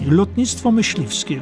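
ordinary delivery, nothing marked

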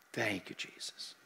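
soft, slow whispered prayer; whispered thank you Jesus at the bedside. Shown as a demonstration. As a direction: breathe the thanks under your breath. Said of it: "And I just be there".

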